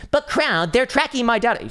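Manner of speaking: high, loud whiny mock voice; mocking whiny crypto kid. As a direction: parody a complaining crypto kid. Mocking crypto kids' complaint.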